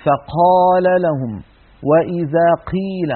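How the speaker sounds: "فقال" و"قيل" بهواء يتدفق مع القاف; قاف مهموسة ينفلت معها النفس; breathy qaf. Shown as a demonstration. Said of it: "اعتاد بعض الناس أن يقول قا". (قاف مهموسة بتدفق الهواء)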